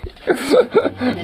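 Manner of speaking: a shrill burst of laughter; high squealing laugh. Real, not acted. Embarrassed after own joke.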